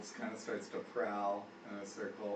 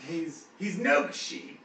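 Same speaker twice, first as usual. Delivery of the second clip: very slow, loud orc insistence; orc Guz; 6 semitones higher, 6 dB louder, much slower than usual. Acted. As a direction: drag it out stubbornly. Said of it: "Guz looks at you and goes".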